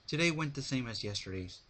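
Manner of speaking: ordinary delivery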